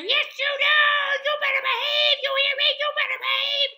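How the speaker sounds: tiny creature's very high, fast shriek; squeaky screaming. A narrator's reading. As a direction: scold at full squeak, very loud and rapid. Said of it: "Alfred started screaming in his ear".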